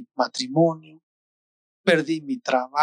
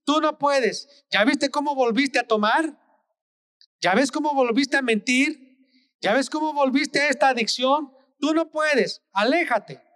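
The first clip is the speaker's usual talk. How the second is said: mocking devil voice, pitched higher; devil whispering; 8 semitones higher than usual. Preacher voicing the devil taunting the fallen believer, high mocking voice. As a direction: taunt like the devil murmuring in their ear. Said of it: "El diablo te va a susurrar".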